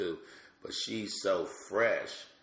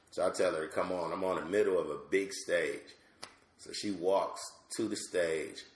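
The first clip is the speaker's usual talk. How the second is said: ordinary delivery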